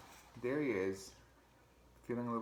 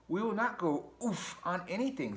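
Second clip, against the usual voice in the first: higher put-on voice, stern; cross Mouse voice; 10 semitones higher, 4 dB louder than usual. Mouse scolding Ratty about his sofa, stern put-on voice. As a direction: Mouse refusing, prim and firm.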